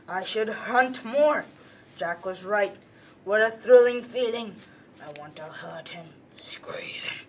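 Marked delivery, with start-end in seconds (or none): 5.0-7.3 dark whisper. Acted. Ralph lost to bloodlust.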